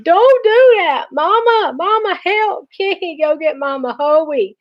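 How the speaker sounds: very high, frantic wail; going nuts. A narrator's reading. Stuck brother screams for Mama as his ear is tugged, going nuts. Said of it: "Vi went nuts again".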